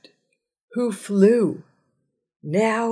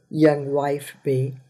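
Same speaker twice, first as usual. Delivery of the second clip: ordinary talk, nothing marked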